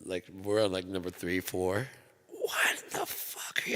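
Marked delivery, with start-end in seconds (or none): 2.3-3.6 slow stunned whisper; dad's whisper. Retold or imitated. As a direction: breathe it out slowly like a shocked dad. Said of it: "So my dad comes in".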